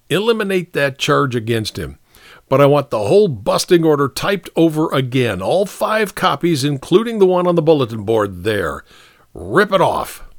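commanding captain. Acted. Captain ordering the sergeant to redo the paperwork, commanding. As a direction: give grudging, firm orders.

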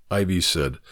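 ordinary delivery, nothing marked

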